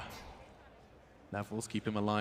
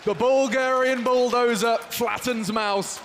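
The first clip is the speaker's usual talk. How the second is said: high, loud, slow hype; excited shout; 12 semitones higher, 5 dB louder, slower than usual. Real, not acted. Co-caster praising the youngster, loud and high with excitement. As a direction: ride the wave of the crowd.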